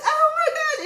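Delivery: high, loud, laughing disbelief; shocked exclamation. Retold or imitated. Student sees older classmate hide her paper, shocked.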